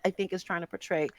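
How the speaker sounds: ordinary delivery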